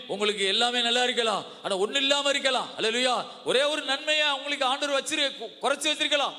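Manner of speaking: high, impassioned sermon voice; full-force preaching. Real, not acted. (உரத்த ஆவேசப் பிரசங்கம்)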